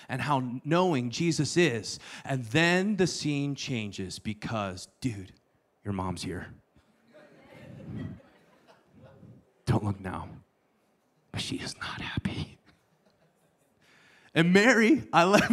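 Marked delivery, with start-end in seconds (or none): 3.5-12.6 low, soft warning, dropping to a whisper at the end; a friend quietly warns the boy Jesus that his mom has arrived; hushed warning. Pretend. Warning Jesus his mom's here. 14.3-15.5 excited. Real, not acted. Excited about Mary's entrance.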